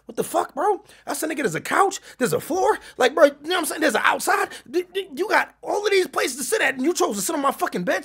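high-pitched, outraged mock yell at the guy; retold exasperated yell. Re-enacting yelling at a smelly guest on his hotel bed, high mock outrage. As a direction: squeal it like you can't believe he sat on your bed.

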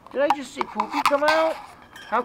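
high, fast surprised question. Real, not acted. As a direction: gasp at what you just saw. Surprised at the kitten.

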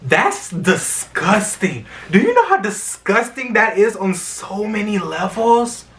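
drawn-out verdict rising into high, loud outrage; indignant disgust. Real, not acted. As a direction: let real indignation climb in pitch.

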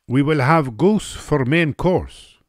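high, loud, slow pronouncement; Russian hostess laying down the menu; decisive hostess. A narrator's reading. Russian woman decides the menu.

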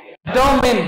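"डिटरमाइन" ending in a short "-min"; correct pronunciation. Shown as a demonstration. Teacher answering his own question to the class, correctly pronounced. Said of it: "इसका correct pronunciation होता है".

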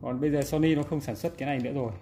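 normal talking voice

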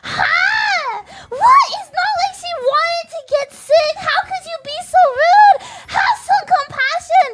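hammed-up furious scolding; angry outburst. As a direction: yell at the rude woman in mock fury. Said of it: "I got so mad at her".

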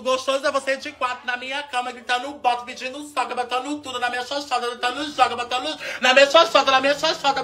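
high, rapid-fire chatter; quick high-pitched goading; teasing patter. Pretend. Teases the dog with patter.

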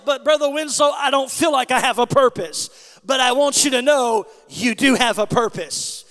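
loud, driving sermon delivery; preaching at full force. Real, not acted. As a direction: exhort the whole congregation at a near shout.

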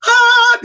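very high, loud fan squeal; ecstatic shriek. Retold or imitated. Fan excitement at Gene's offer.

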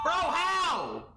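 bro, how, half yelled at the screen; frustrated outburst. Real, not acted. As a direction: blurt it out, fed up with the game. Stuck in horror game, frustrated.

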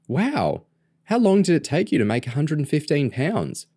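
put-on high-pitched, amazed girl's voice; impressed little sister. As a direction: play a wowed younger sister. Narrator voices impressed young sister.

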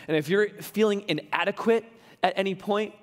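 ordinary talk

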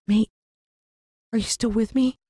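high, worried plea; anxious plea. A narrator's reading. Pleading with the rescued dog.

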